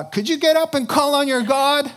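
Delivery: high, loud panicked demand to wake up; panicked captain. Retold or imitated. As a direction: shout down at the sleeping prophet in alarm. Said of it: "this panicked, unbeliever".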